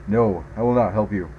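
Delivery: usual speaking voice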